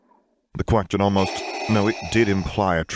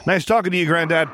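hasty cheerful goodbye; 12 semitones higher, 4 dB louder than usual. A narrator's reading. Jacen hurries sister out.

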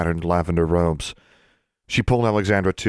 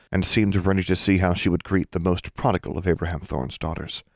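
ordinary delivery, nothing marked

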